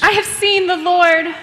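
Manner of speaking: high, loud, elated proclamation; joyful announcement. Acted. Mary bursting in on the disciples with joyful news.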